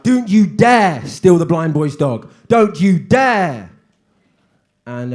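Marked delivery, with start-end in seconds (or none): 0.0-3.7 loud, slow, scolding headteacher; mock stern assembly. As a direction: scold the whole assembly slowly. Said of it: "they were like".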